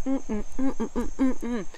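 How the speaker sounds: high sassy humming; approving hum. Acted. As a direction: hum it with sass.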